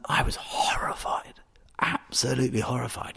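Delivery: hushed, appalled whisper; horrified whisper. Real, not acted. Wine merchant recalling his first peaty whisky, whispering. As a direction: breathe it out in a whisper.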